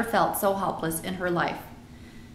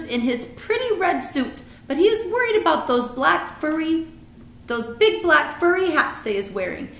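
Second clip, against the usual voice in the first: gossiping giant, high and emphatic; BFG; 9 semitones higher, 5 dB louder than usual. A narrator's reading. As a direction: relish the report as a friendly giant.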